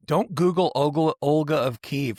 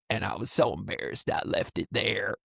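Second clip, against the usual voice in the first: put-on lower, softer husky old lady; old lady smoker voice; 7 semitones lower, 5 dB quieter than usual. Prank caller explaining to the trucker in smoker's voice. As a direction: sigh it out like an embarrassed old smoker. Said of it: "old lady smoker's voice".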